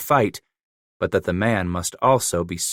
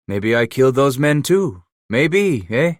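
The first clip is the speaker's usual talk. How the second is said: put-on lightly French-accented broken English, a touch higher; Emile's French accent; 5 semitones higher, 5 dB louder than usual. A narrator's reading. Trapper Emile telling Leclerc about his mother, lightly French-accented.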